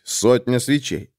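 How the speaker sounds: plain reading voice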